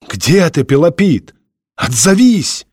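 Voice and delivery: urgent call, climbing at the end; anxious calling. A narrator's reading. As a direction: call out for a missing friend. Friends calling down to silent man.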